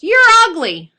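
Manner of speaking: very loud, high parrot yell; full-volume, high bird squawk. Acted. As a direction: yell the insult as loud as you can. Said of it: "as loud as he can, the bird yells".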